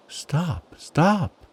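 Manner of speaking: high-pitched, urgent storybook cry; excited frog calling a halt. A narrator's reading. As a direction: cry out in agitation, pitch leaping up. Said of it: "cried the frog, hopping about excitedly".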